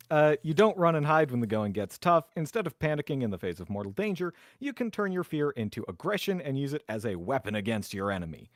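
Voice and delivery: ordinary talk, nothing marked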